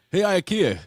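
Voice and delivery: loud, high mock holler; mock calling out. Comic calling to Ikea for a chair, mock holler.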